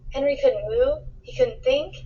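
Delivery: plain reading voice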